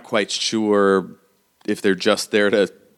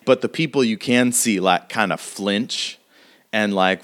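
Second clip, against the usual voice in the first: usual speaking voice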